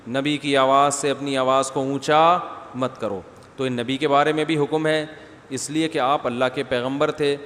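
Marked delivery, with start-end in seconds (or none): none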